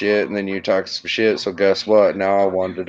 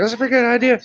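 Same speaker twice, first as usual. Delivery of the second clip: higher-pitched quote of his own impulsive thought; retold eager thought; 12 semitones higher, much faster than usual. Caller retelling his impulsive first reaction, in a raised, eager voice.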